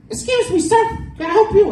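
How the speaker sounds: high, prissy front-desk lady voice; prim school receptionist. Retold or imitated. School lady questioning the stepdad, high prim voice.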